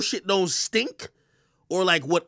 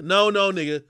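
ordinary delivery

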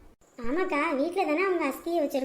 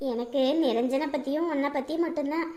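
ordinary delivery

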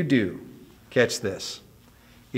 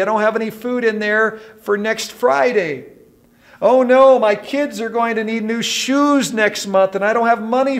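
high, loud, hammed-up fretting; mock panic; 9 semitones higher, 5 dB louder than usual. Preacher acting out people fretting over bills, mock panicked. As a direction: wail each worry as a comic emergency. Voicing people's everyday money worries.

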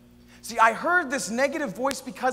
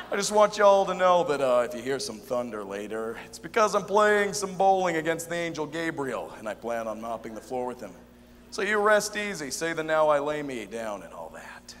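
folksy voicemail voice, sinking low at phrase ends; friendly, fun God; 4 semitones lower, 4 dB louder than usual. Acted. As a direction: leave a chummy, easygoing message. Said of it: "something more fun, a voice that was familiar".

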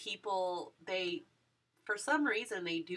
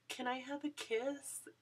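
timid whispered question, a little higher; shy whisper; 6 semitones higher, 7 dB quieter than usual. Retold or imitated. Fan asking her idol for a kiss, whispering shyly. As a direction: barely dare to ask it.

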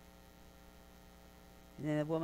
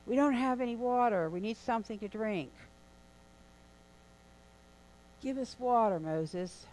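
high-pitched, pleading cry of the Israelites; desperate, higher plea for water; 7 semitones higher, 3 dB louder than usual. Retold or imitated. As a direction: wail it up high like thirsty complainers. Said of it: "they're crying out".

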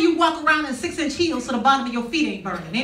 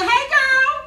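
cheery greeting; 13 semitones higher, 6 dB louder than usual. Retold or imitated.

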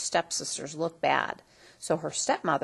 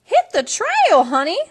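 put-on loud, higher twangy old lady; cowgirl fairy godmother; 11 semitones higher, 11 dB louder than usual. A narrator's reading. Fairy godmother greeting Cindy, loud and sassy. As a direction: play a sassy frontier granny. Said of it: "the old lady said".

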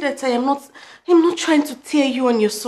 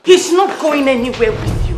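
loud, forceful refusal; defiant outburst; 3 semitones higher, 8 dB louder than usual. Acted. Girlfriend defying his mother, loud and defiant. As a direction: cut in hard and stand your ground.

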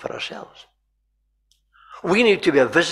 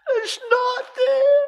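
put-on very high, slow child's wail; drawn-out wail of a little girl; crying scream; 5 dB louder, much slower than usual. Wee girl still inconsolable at the scene, wailing. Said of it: "crying away and screaming".

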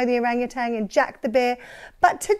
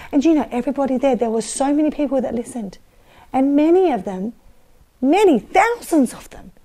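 usual speaking voice

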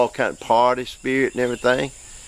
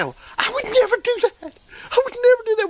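squeaky high falsetto, mocking a prim churchgoer; hammed-up high whine of mock shock; mock prissy objector; 3 dB louder than usual. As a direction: pinch it up high like a scandalised critic.

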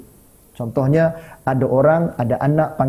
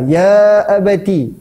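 long-held, louder recited call; drawn-out address; 6 semitones higher, 6 dB louder, much slower than usual. Acted. Comparing with Ismail's answer to his father, drawn out. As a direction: stretch the first word out and lift it.

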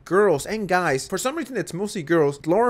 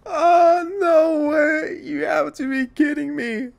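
disbelieving cringe; 10 semitones higher, 5 dB louder, much slower than usual. Real, not acted. Cringing at awful clip.

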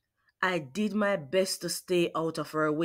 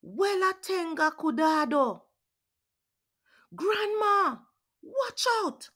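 warning; 10 semitones higher than usual. Acted. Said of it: "I warned".

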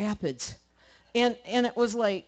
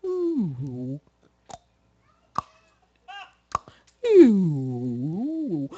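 imitated moose call; 4 semitones lower, 4 dB louder than usual. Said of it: "a sound like that".

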